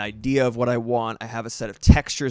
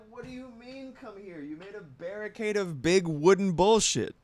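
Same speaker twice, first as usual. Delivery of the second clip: higher, softer put-on cat voice; mock indignant pet voice; voicing the cat; 9 semitones higher, 3 dB quieter than usual. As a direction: speak for the cat, sassy and offended. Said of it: "she's like".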